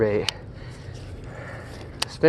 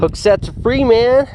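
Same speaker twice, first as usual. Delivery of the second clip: excited; 11 semitones higher, 6 dB louder than usual. Real, not acted. Missed hookset, exclaiming.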